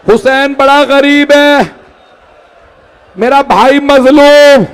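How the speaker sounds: high, loud, slow wailing cry; loud grieving lament. A narrator's reading. Zainab mourns her slain sons aloud, in a loud high lament. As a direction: cry out in grief to the fallen sons. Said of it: "बुलंद आवाज से कहा".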